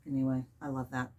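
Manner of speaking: usual speaking voice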